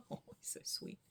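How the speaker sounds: unvoiced soft whisper. Real, not acted. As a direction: breathe it out tenderly. Whispered aside about cat.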